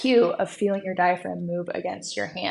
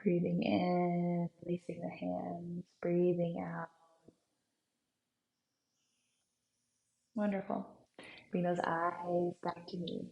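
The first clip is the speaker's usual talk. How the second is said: guided meditation; 10 dB quieter than usual. Acted. Guided breathwork, soft voice.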